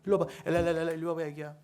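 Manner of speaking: put-on high, sing-song cooing to a baby; baby talk. Adult cooing at a baby, sing-song baby talk. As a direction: coax a toddler over with baby babble. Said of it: "애기들한테 하는 거".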